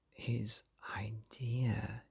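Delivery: slow, soft unvoiced whisper; breathy storyteller whisper. A narrator's reading. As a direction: hush it like a secret. Narrator hushes the big moment.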